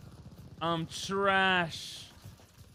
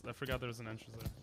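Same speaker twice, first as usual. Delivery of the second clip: normal talking voice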